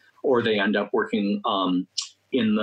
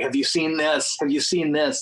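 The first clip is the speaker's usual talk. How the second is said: higher-pitched, eager movie-buff excitement; excited sharing; 6 semitones higher than usual. Acted. Movie buff digging down with friends, excited. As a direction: act out breathlessly keen film-fan discovery. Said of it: "sharing that excitement is the best".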